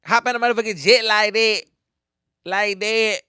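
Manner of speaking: high, loud swaggering imitation; friend's street talk. Repeating a TikTok line as a friend would, high street-talk imitation. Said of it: "I had a friend who used to talk like that".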